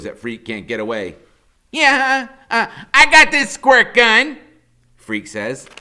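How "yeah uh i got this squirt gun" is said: high, loud and slow, theatrical; hammy kid hero threat. A narrator's reading. Freak threatening Killer Kane with his squirt gun. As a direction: savour the threat like a movie hero.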